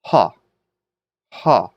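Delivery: "ha" with an audible H at the start; rough breathing. Shown as a demonstration. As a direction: sound out "ha", leading with a puff of H. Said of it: "sort of an H sound".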